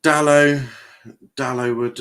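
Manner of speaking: ordinary delivery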